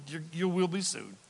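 ordinary delivery

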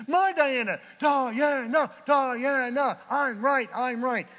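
loud, high repeated outburst; hammered-out shouts, high and insistent; mock yelling. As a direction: act out yelling in stubborn protest. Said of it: "That's not yelling".

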